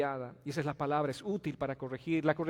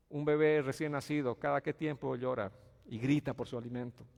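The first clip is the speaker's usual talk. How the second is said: usual speaking voice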